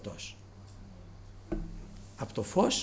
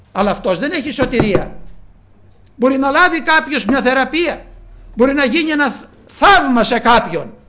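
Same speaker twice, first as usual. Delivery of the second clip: loud, rising sermon questions pressed on the flock; preaching at full force; 11 semitones higher, 12 dB louder than usual. Acted. Preacher asking the congregation why they came, loud preaching. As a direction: call out to the whole church, demanding an answer.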